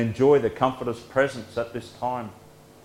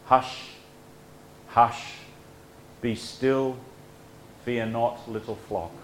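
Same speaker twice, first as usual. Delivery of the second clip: cooing; 8 dB quieter than usual. A narrator's reading. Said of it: "Like a doting mother cooing to her newborn baby".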